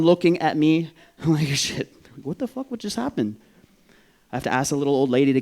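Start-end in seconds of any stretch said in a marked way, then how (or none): 1.5-3.4 shocked reaction. Retold or imitated. Passengers' alarmed reaction imitated.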